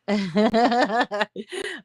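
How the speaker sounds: real, sustained chuckle; amused laugh. Real, not acted. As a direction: laugh it out before carrying on. Amused by a viewer's comment.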